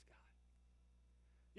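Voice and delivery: breathed, voiceless prayer; whisper. Acted. Preacher closing the prayer for protection to God, whispering. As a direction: let the name fall to a whisper.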